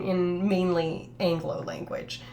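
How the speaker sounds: normal talking voice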